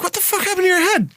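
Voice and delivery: very high, fast squeal of disbelief; shocked barber. Retold or imitated. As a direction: imitate an aghast barber, pitch way up. Repeats the barber's shocked question.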